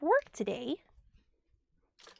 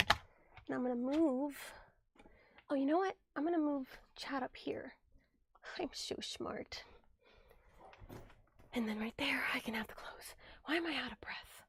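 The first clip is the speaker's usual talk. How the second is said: whisper; 9 dB quieter than usual. Real, not acted. Whispering to herself while organizing.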